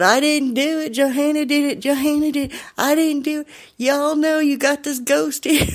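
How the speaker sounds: high-pitched imitation of a panicked man; frantic protest. Accused man protests to bouncer.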